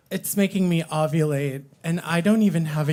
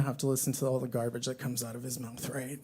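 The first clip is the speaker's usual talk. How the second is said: softer, fast aside to a friend; whisper aside; 7 semitones lower, 11 dB quieter, faster than usual. Retold or imitated. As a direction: mutter it so he can't hear. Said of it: "I was like".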